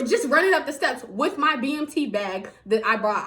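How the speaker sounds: ordinary talk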